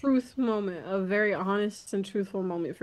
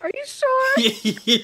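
high, slow, laughing question; fake-sweet 'are you sure', stretched out; mock innocent ask; 5 dB louder, much slower than usual. Co-host replays asking if he's sure, high slow laughing voice.

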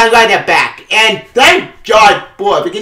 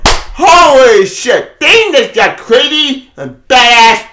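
slow, drawn-out hyped exclamation, louder and higher; excited; 6 semitones higher, 4 dB louder, much slower than usual. Real, not acted. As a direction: savour the shock, stretching it out. Hyped reaction to comic twist.

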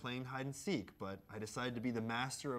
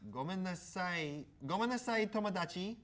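playful mock Japanese accent; 6 semitones higher than usual. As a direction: ham up a sing-song Japanese sorry.

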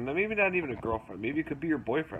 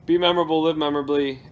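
loud sign-off; 3 semitones higher, 7 dB louder, faster than usual. Real, not acted. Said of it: "outro sign-off catchphrase".

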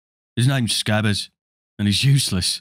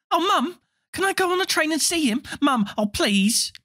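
put-on little girl voice. Little girl pleads with mother.

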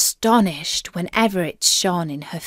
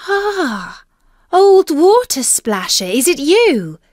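high, raised princess voice; princess exclaiming; 6 semitones higher, 5 dB louder than usual. A narrator's reading. Princess recognising the frog at the well, exclaiming. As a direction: call out higher and louder, as the princess.

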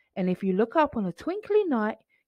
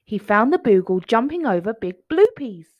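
usual speaking voice

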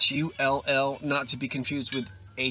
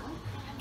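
gasp; 14 dB quieter than usual. Real, not acted. Finding a glass piece.

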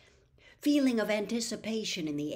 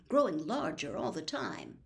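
plain reading voice

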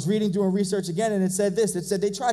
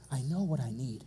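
quiet resolve; 5 semitones lower, 6 dB quieter than usual. Retold or imitated. Blind man's resolve, voiced low.

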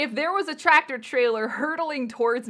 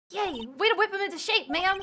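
put-on cheery girl voice; 7 semitones higher than usual.

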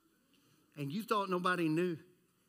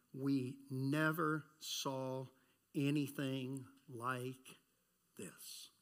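slow and softer, fading to a whisper; awed hush; 7 semitones lower, 7 dB quieter, much slower than usual. Acted. Preacher voicing the amazed crowd, hushed and slowing. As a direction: let amazement drain the voice away.

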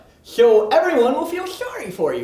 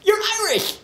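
excited exclamation; 10 semitones higher than usual. Acted. Sudden high yell.

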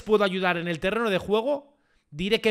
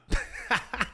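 short burst of laughter; 6 dB quieter than usual. Real, not acted. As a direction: break into a quick laugh. Laughs after joking announcement.

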